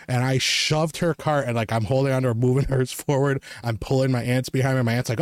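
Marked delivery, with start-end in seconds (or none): none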